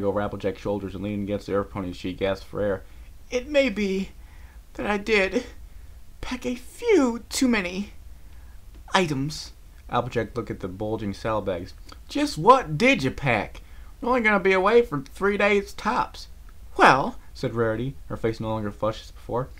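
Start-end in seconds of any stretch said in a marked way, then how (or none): 3.3-9.5 much higher, halting posh voice; sheepish admission. Acted. Rarity admits overpacking, out of breath. 12.1-16.3 higher, louder disbelief; incredulous. Acted. Applejack questions Rarity's packing.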